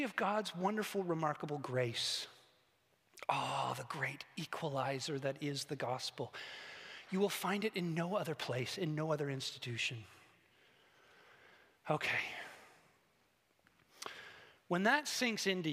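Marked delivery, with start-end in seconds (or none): none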